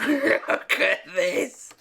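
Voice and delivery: high, slow words through a laugh; real laughing dismay. Real, not acted. Painter laughs at the botched body length, incredulous. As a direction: laugh helplessly at your own mess.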